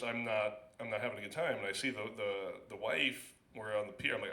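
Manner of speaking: usual speaking voice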